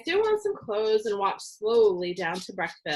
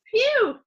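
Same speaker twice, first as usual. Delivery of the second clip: phew; 6 dB louder than usual. A narrator's reading. Waving hand at bad breath.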